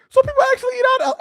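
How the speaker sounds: high, fast, squeaky disbelief; shocked disbelief. Real, not acted. Streamer reacting to chat, shocked, high and fast. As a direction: voice jumps way up in shock. Shocked by chat's alligator news.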